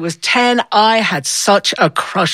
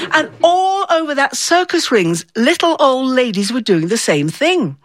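plain reading voice

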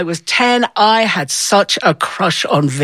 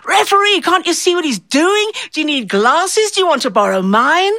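aunt heckling the referee, high-pitched and shrill-edged; mock old lady yelling; 9 semitones higher than usual. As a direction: play the furious little old lady at ringside. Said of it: "she was saying".

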